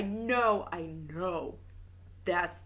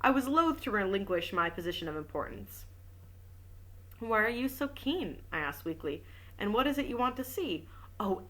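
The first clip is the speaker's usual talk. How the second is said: even audiobook reading voice; story narration; 3 semitones higher than usual. A narrator's reading. Reading a detective novel aloud, steady narration. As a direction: read the novel aloud to listeners.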